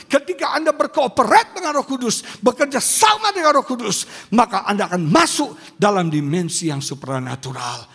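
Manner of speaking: loud, driving exhortation; full-force preaching. Real, not acted. Pastor urges congregation to work with Holy Spirit, preaching forcefully. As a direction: build loud, emphatic declarations to the congregation.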